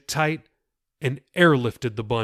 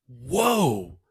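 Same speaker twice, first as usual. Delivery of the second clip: amazed whoa. A narrator's reading. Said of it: "Jacob said".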